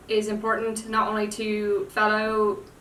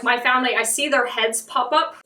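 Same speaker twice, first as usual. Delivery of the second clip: ordinary delivery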